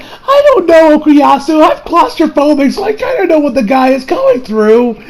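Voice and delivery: high-pitched worried acted voice; anxious friend. Retelling Koichi sympathizing with the trapped man, anxious. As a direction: voice a nervous friend, pitch well up. Retold claustrophobic friend's worry.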